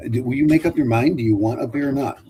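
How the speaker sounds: ordinary talk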